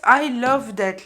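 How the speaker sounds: loud, slow burst of delight. Real, not acted. Tarot reader thrilled by the card, loud and slow. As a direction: cry out in love with what you see. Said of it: "this makes me emotional".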